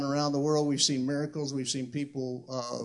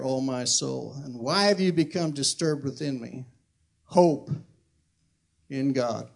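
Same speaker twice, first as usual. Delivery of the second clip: scripture reading. Acted. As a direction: declaim the psalm, letting phrase ends settle. Reads scripture aloud.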